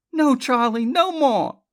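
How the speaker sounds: high, loud and firm; firm refusal. A narrator's reading. Elizabeth refuses Charlie, firm. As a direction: put your foot down. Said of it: "Elizabeth said firmly".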